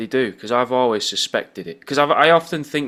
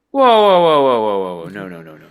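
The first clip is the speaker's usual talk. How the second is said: mock alarm; 7 dB louder than usual. Halting people who found out about the AI, mock alarm fading away. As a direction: start with a big yelp, then tumble down and fade.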